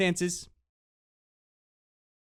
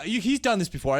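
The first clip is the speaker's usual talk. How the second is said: ordinary delivery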